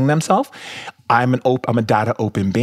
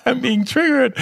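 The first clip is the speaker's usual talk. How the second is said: laughing through the words, high. Real, not acted. Laughing as she tells the host about her girlfriend. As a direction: giggle out the confession.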